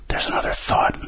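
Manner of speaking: whispered inner voice. Shown as a demonstration. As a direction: breathe it like the mind's voice. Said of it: "I'll translate that for you".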